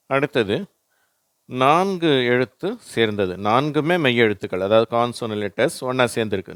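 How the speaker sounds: ordinary talk, nothing marked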